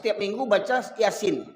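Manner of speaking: normal talking voice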